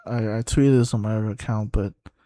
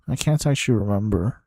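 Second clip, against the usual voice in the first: ordinary talk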